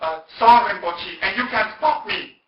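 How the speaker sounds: loud, high, drawn-out defiance; high-pitched mock indignation at full volume; indignant woman. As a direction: imitate an affronted woman refusing to be stopped. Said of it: "then she became very indignant".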